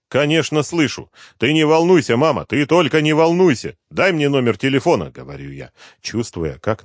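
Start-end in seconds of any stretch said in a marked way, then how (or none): 0.1-5.1 high, loud, pressing reassurance; calm her down while frightened yourself; urgent reassurance. Acted. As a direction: son urgently calming his panicked mother. Hero calming his mother.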